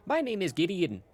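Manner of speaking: chirpy, apologetic innkeeper, lifting in pitch; Gideon. Acted. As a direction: play a cheerful tavern keeper introducing himself.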